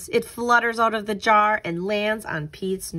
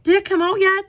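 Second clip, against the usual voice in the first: high, eager question, louder; eager boy; 10 semitones higher, 6 dB louder, faster than usual. Acted. Marty asks Pete about the caterpillar, high and eager. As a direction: ask it with impatient excitement.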